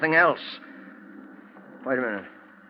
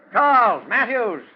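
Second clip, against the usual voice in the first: high, loud call across the grounds; calling a name into the storm; 8 semitones higher, 4 dB louder than usual. Acted. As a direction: shout for the servant. Calling for the servants outside.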